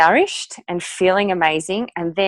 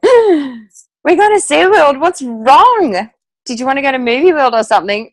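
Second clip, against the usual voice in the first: a husband suddenly worried and shouting in alarm; startled husband; 8 semitones higher, 8 dB louder than usual. Retold or imitated. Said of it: "my husband turned and looked at me and went".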